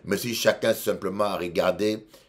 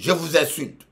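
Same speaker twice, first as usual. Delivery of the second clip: a much higher, louder mock shout of the insult; 10 semitones higher, 6 dB louder than usual. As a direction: fling it out like the provoked pastor. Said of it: "Il a dit". (cri imité du pasteur, bien plus aigu et fort)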